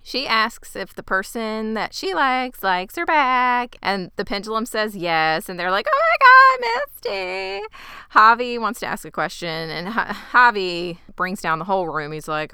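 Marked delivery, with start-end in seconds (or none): 5.9-7.6 mock excited squeal. Girls tease Misty about who likes her back, mock squeal. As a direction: imitate friends squealing over a crush.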